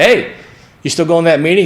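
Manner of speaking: eager, high, loud call. Retold or imitated. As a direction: call down the hall to catch someone. Calling to Gary in the halls.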